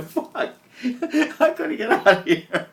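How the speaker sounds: loud slow words through laughter; laughing. Real, not acted. Imagining the wise man fleeing, laughing loudly. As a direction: crack up mid-quote.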